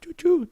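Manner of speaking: high train whistle imitation; train whistle choo-choo. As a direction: toot it like a toy train. Mimicking a train after "choo-choo train.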